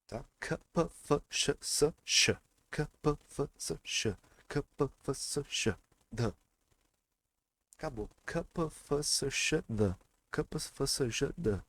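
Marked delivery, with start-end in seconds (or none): 0.4-6.3 K, P, F, S, X as short rhythmic puffs; drilled consonant sounds. Shown as a demonstration. Teacher drilling students to repeat, rhythmic consonant sounds. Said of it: "tentem repetir isso". 8.2-11.6 K, P, F, S, X as short rhythmic puffs; drilled consonant sounds. Shown as a demonstration. Teacher drilling students to repeat, rhythmic consonant sounds. Tentem repetir isso.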